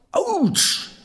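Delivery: high, loud pained yelp; devil's yelp. Acted. Devil yelps when his hair is pulled, loud high shriek. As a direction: cry out as the hair is ripped out. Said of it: "schrie der Teufel".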